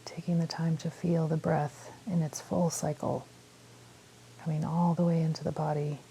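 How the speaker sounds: calm meditation guide with long pauses; unhurried phrases, leaving space to breathe; guided meditation. Real, not acted. Guiding listeners through a body scan, calm meditation voice.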